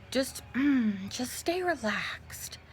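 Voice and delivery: slow, hushed imitation of a creepy soothing whisper. Doctor telling the patient to relax, imitated slow creepy whisper. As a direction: whisper it slowly, falsely soothing.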